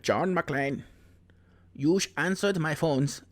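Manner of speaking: put-on movie villain with a clumsy German accent; hammed-up German bad guy. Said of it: "That's a very bad German accent".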